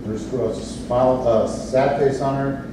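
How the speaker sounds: ordinary talk, nothing marked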